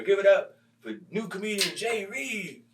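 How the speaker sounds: high, slow emcee announcement; mock emcee introduction; host intro. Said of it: "So Alex bring me".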